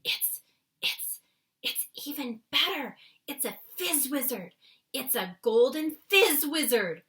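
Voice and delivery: giddy with delight over the dream; a thrill building in the giant's voice. A narrator's reading. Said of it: "whispered with a thrill in his voice".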